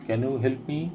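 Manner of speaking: ordinary delivery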